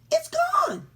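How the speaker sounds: very high, loud wail of loss; alarmed cry. Acted. Character discovers book is missing.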